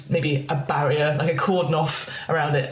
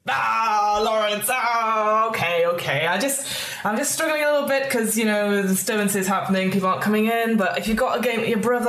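Mister Moon; 3 semitones higher than usual. Acted. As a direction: sigh it out, then plead about the trade. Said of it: "really sort of goes".

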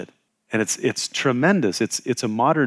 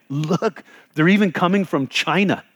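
excited exclamation; 6 semitones higher than usual. Retold or imitated.

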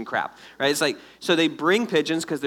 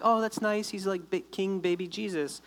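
soft, lifted, cooing admiration; mock cooing; 3 semitones higher, 6 dB quieter than usual. People cooing over baby Jesus, mock-sweet and soft. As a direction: coo over it lightly, very softly.

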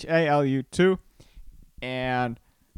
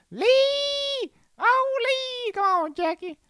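much higher, yelling Rush Hour sidekick calling out; Chris Tucker impression; much slower than usual. Podcast host riffing on Hurricane Lee, much higher frantic calling. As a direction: squeal the name like a frantic buddy. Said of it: "I can't do a Chris Tucker impression".

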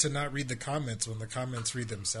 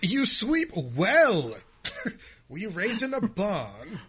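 mock posh British; 6 semitones higher, 4 dB louder than usual. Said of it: "outlandish British accent".

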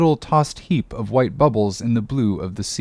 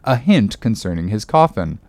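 usual speaking voice

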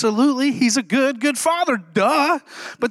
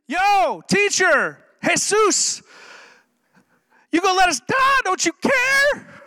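higher, frantic plea, with a far higher, slow wail on the last don't you care; panicked disciples; 7 semitones higher, slower than usual. Acted. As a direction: beg like men sure they'll drown.

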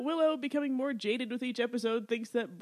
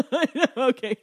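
loud, high, through real laughter; laughing agreement; 3 semitones higher, 11 dB louder than usual. Real, not acted. Co-host agreeing it's hilarious, laughing. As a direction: burst out laughing as you agree. Co-hosts crack up over coming bit.

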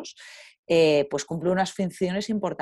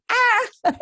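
high startled shriek; sudden high yelp of surprise; 4 dB louder than usual. Retold or imitated. Startled by rangers in the river.

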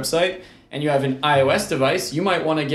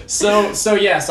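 laughing; 7 semitones higher, 4 dB louder, slower than usual. Real, not acted. Laughing after the joke.

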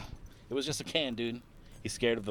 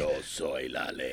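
deep slow dub voice; 10 semitones lower, 6 dB quieter, slower than usual. Retold or imitated. Echoing the dub line.

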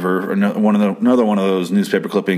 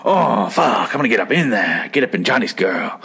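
lightly hammed-up lecherous creep; creepy man; 2 semitones higher than usual. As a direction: imitate a creep drooling over a girl. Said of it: "he's fucking creepy".

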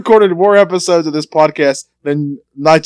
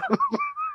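high squeaky giggle; 14 dB quieter than usual. Real, not acted. Cracks up at his own skit.

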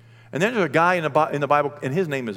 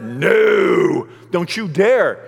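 loud, firm forbidding voice; God forbidding; 6 semitones higher, 10 dB louder, much slower than usual. Acted. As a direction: forbid it loudly and firmly. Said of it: "And my God said".

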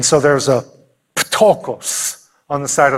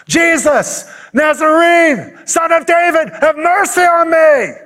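high, desperate cry over a crowd; beggar's pleading scream, strained and high; 13 semitones higher, 3 dB louder, slower than usual. Acted. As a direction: holler it out, pitched way up on long held notes. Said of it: "the screams of a ptochos over the crowd".

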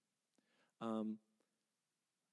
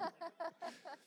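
high laugh; 11 dB quieter than usual. Real, not acted. Laughing at own mock voice.

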